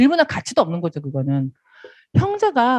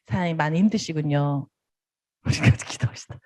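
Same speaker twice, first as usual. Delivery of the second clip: softer, hushed comfort, partly unvoiced; gentle consoling; 4 semitones lower, 6 dB quieter than usual. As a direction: soothe a struggling partner quietly. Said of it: "이렇게 할 수밖에 없는 거죠".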